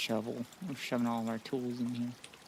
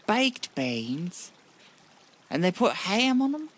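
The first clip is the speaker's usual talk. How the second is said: incredulous mock-British exclamation, rising higher and louder; exaggerated British accent, pitched up in disbelief; British impression; 8 semitones higher, 5 dB louder, slower than usual. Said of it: "my British impression".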